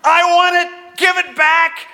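hammed-up screaming baby demand; imitating toddler screaming. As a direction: wail it like a baby robbed of her toy. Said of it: "she starts screaming".

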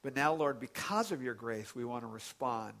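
soft, hushed reverent prayer; closing prayer. Real, not acted. Pastor leading the congregation in prayer, quiet and reverent. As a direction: pray gently to the Lord.

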